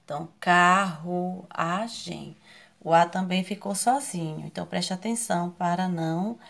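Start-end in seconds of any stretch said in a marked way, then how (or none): 0.4-2.4 carruagem in four slow beats; carruagem, each syllable set apart. Shown as a demonstration. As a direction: split carruagem as car... ru... a... gem. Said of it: "em sílabas separadas".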